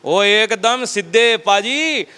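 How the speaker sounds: ordinary talk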